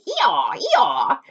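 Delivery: high, loud hee-haw bray; donkey bray. A narrator's reading. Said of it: "he had such a wonderful bray".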